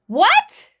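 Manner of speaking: high, loud stunned What; retelling a creepy remark, outraged; shocked what. Real, not acted. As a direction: burst out in disbelief.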